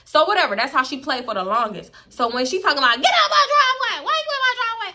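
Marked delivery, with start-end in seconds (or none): 3.0-4.9 very high imitation of a hollering mama; hammed-up high-pitched scolding; mock yelling. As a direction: squawk it like a scolding mother. Repeats mama's driveway scolding.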